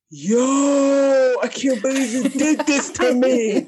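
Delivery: high, mocking whine; hammed-up wounded boyfriend; whiny boyfriend. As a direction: ham up his outrage. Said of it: "he's like".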